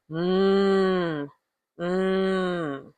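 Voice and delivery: "mm, mm" as two long closed-lip hums; M sound. Shown as a demonstration. Teacher showing what M is, M sound. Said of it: "M is".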